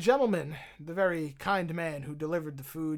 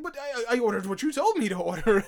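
fast, high, loud flustered protest; hammed-up flustered excuse; panicked defense; 8 semitones higher, 10 dB louder than usual. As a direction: blurt it out in a panic. Panicked reply retold.

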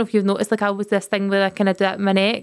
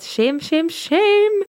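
mock shame; 8 semitones higher, much slower than usual. Mock-shaming herself before confessing.

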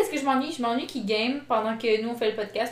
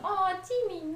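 cooing at a kitty; 8 semitones higher, 7 dB quieter than usual. Retold or imitated. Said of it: "que t'es genre".

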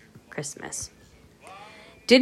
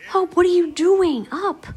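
high-pitched, drawn-out acted surprise; surprised questioning; 11 semitones higher, slower than usual. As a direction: imitate someone puzzled to find her up, slow and high. Acted surprised question on finding her.

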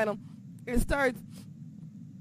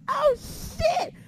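much higher, startled yell; shocked shout. Retold or imitated. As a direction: blurt it in shock. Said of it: "I'm just like".

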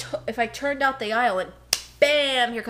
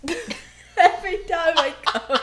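laughing strain; 7 semitones higher, 4 dB louder than usual. Real, not acted. Laughing while lifting.

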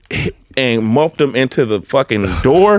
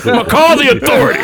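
very high, hammed-up prissy threat; mock-official warning with hands on hips; hands-on-hip indignation; 14 semitones higher, 4 dB louder, faster than usual. Clerk threatening the renter, hammed-up indignant. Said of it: "I put my hands on my hip after I said it".

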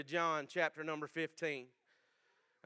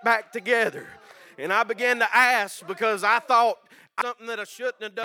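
impassioned, much louder preaching; 4 semitones higher, 11 dB louder than usual. Real, not acted. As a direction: testify with rising fervor. Preaching testimony at full force.

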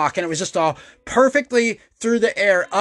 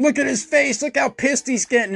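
mocking taunt; 7 semitones higher than usual. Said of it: "laughing, pointing at me, giggling".

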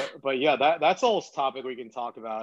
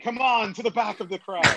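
bullhorn director; 8 semitones higher than usual. Pretend. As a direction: call it out to the back row. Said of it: "A director's chair with a bullhorn".

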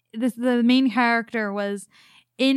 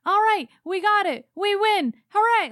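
mock triumphant cheer; 9 semitones higher than usual. Mocking happy ending of plot.

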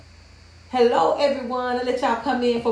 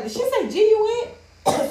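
retold shocked disbelief; 11 semitones higher than usual. Retold shocked reaction to outburst.

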